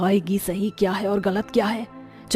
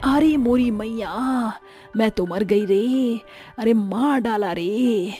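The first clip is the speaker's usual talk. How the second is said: mock wail of pain; slower than usual. Mother-in-law fallen, wailing.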